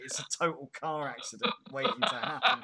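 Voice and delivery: loud, high, talking through real laughter; laughing hard. Real, not acted. Cracking up at a misread chat comment, loud laughing speech. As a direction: crack up while getting the words out.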